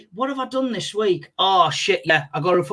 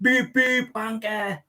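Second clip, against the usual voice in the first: retold horn and insult; 2 semitones higher, 6 dB quieter, much slower than usual. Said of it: "and I went".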